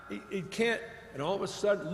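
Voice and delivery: usual speaking voice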